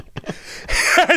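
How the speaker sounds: breathless high giggles; can't stop laughing at the memory; helpless laughter. Real, not acted. Telling his friend the story, laughing hard.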